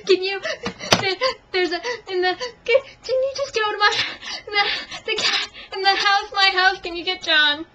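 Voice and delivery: high, loud mock sobbing with gasps between words; hysterical sobbing, imitated. Pregnant woman begging neighbor kid for help, imitated hysterical sobbing. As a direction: gasp out broken phrases as if bawling. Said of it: "So I'm hysterical".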